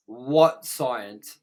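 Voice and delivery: normal talking voice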